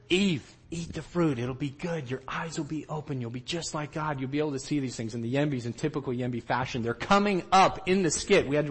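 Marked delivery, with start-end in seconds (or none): 0.1-5.1 tempting Satan. Acted. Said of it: "I'm tempting Eve, and I'm telling her".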